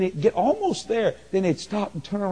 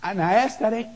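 ordinary talk

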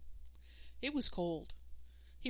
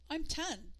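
put-on high squeaky boy; squeaked; 8 semitones higher than usual. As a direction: squeak it out in shock. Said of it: "Adam squeaked".

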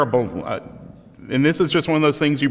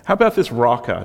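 normal talking voice

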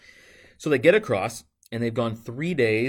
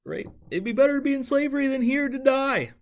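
high mock whine, far above the plain voice; hammed-up griping people, pitched much higher; whiny complaining Israelites; 13 semitones higher than usual. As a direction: whine it like a spoiled crowd begging to go back. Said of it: "They say".

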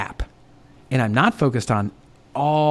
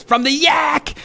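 hometown shout; 13 semitones higher, slower than usual. Retold or imitated. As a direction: call "From the yak!" out proudly, pitched way up. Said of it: "he goes".

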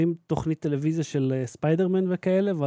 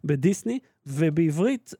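ordinary talk, nothing marked

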